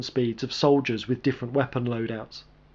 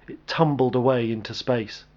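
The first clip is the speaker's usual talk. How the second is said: usual speaking voice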